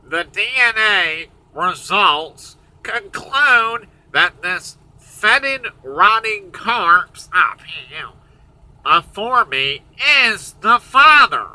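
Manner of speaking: slow, dragged-out dramatic verdict; mock results announcement. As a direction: announce it slowly, word by word. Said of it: "he says".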